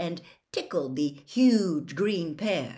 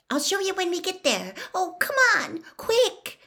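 high, impatient pleading; urgent hurrying; 12 semitones higher than usual. A narrator's reading. Hermione dragging Harry down the corridor, high and urgent. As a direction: urge them along in a high, eager voice.

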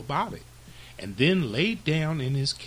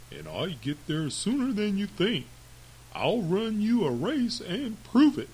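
higher-pitched storybook tortoise; tortoise issuing a challenge; 7 semitones higher than usual. Acted. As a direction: answer the taunt in a raised character voice. The tortoise answers the hare's taunt.

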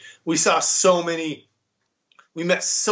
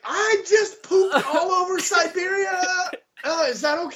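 panicked disbelief; 11 semitones higher than usual. Retold or imitated. Retelling panic on train.

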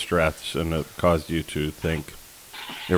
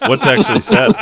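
laughing; 13 semitones higher, 6 dB louder than usual. Real, not acted. Laughing disbelief at the joke.

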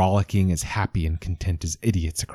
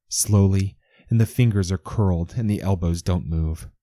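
usual speaking voice

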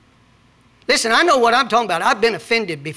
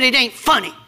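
grumpy "It ain't funny"; sulky retort. Retold or imitated. Pastor retelling his sulky reply to his wife, gruff. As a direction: sulk it out, lightly mocking yourself. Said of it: "I said".

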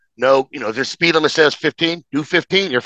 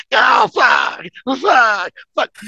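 pained shout; 10 semitones higher, much slower than usual. Retold or imitated. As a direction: yell it out like each bump hurts. Pain on the ride back.

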